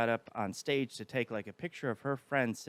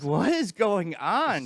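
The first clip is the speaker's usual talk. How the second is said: baffled; 6 semitones higher, 9 dB louder, much slower than usual. Retold or imitated. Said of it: "And I'm just like".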